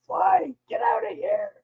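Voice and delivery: ordinary talk, nothing marked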